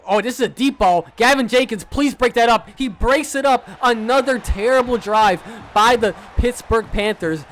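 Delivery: high, loud urgent play-call; tense excited call. Real, not acted. Gamer urging his defender to break up the pass. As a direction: plead for the breakup.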